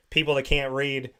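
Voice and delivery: ordinary delivery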